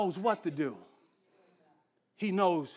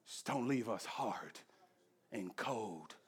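quiet closing prayer; 5 semitones lower, 3 dB quieter than usual. Acted. Pastor praying over the congregation, softly and low. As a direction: pray gently, fading to a hush.

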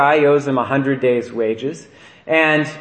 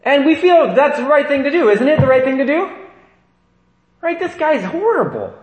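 much higher, mock self-righteous tone; mock outrage; 12 semitones higher, 6 dB louder than usual. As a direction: play the smug judge of the bad servant, high-pitched.

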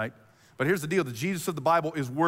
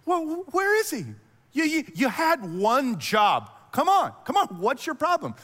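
high, loud, exasperated mock scolding; super irritated; 10 semitones higher, 4 dB louder than usual. Religious elite scolding returning officers, irritated. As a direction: act out a boss fed up with failed underlings.

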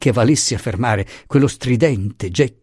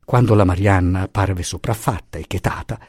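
usual speaking voice

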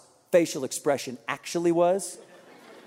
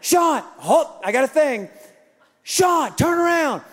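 higher, louder urgent calls; wife calling out; 6 semitones higher, 3 dB louder than usual. Retold or imitated. Wife calling her husband back from the corner, higher and louder. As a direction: get his attention across the field.

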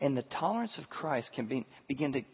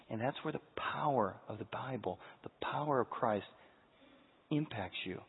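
hushed; 2 semitones lower, 7 dB quieter than usual. Real, not acted. Quiet emphasis on Christ's power.

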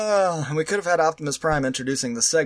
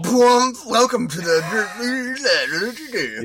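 slowed, warped announcer voice; distorted announcer; 3 semitones higher, much slower than usual. Retold or imitated. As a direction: drag it out like a filtered robot. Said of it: "That's how we got".

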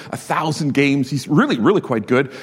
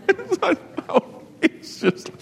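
laughing mockery; 5 dB quieter, slower than usual. Real, not acted. Mocks mental health banner.

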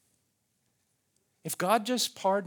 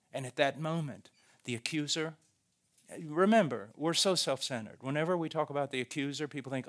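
ordinary delivery

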